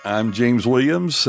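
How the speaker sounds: ordinary talk, nothing marked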